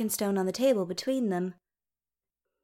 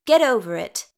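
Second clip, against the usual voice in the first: sharp scolding; 7 dB louder than usual. Acted. Sister scolding brother.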